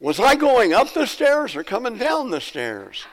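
high-pitched comic call from the stairs; yelling. Acted. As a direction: act out a muddled sister calling out. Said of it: "and then she yells".

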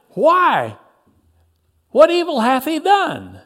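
high, loud challenge; shout. A narrator's reading. Pilate challenges the crowd's demand, shouting. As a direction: shout the question back at them. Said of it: "Pilate shouted".

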